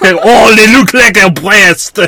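loud, high French exclamation; hammy Frenchman marveling; French accent. Pretend. Mock French explorer again.

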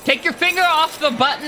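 high, agitated command; flustered guard raising the alarm; urgent order. Acted. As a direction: order them off it, voice jumping up. Guard orders intruder off button.

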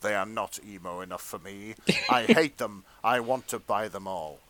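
low, measured story-reading voice; hushed erotica narrator; fanfic narration. Acted. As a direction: read it like a sensual audiobook, played straight.

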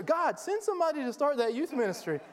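high, pleading mock prayer. The congregation mocked praying for a helper, high plea. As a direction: plead upward like a hopeful believer.

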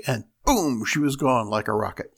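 usual speaking voice

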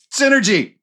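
high, loud burst of a shout; sudden shout. Acted. Owen's player blurting in a sales pitch, shouting. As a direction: shout it out as one excited buzzword. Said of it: "Owen just shouts".

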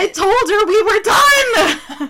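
high, loud, slow mock wail; mock dramatic wail. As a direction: wail it like a soap opera. Acted jealous-ex bit.

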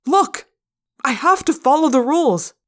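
high, loud self-defence; insist, exasperated; heated protest. A narrator's reading. Narrator defends himself in quarrel.

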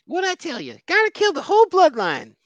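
very high, comic character squawk; acted character voice. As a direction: pitch it way up as a cartoon figure. Acting out a character from episode.

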